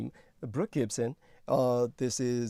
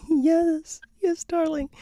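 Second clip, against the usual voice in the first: much higher and louder syrupy croon; fake sweet voice; 10 dB louder, slower than usual. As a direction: gush like a hammed-up lover. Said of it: "They don't like your fake voice".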